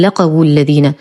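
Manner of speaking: لقوا الذين with the waw wrongly sounded, "laqawu"; mispronunciation demo. Shown as a demonstration. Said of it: "لا نقول". (لقوا الذين بنطق الواو خطأً)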